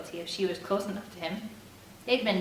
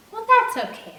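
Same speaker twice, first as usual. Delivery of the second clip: high, louder sweet reproach; Jamie's gentle reproach; 14 semitones higher, 9 dB louder than usual. A narrator's reading. Jamie gently scolding Eric about church, high and sweet. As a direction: chide him kindly from on high.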